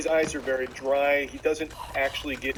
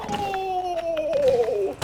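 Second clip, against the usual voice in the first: mock cry; 13 semitones higher than usual. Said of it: "He's like".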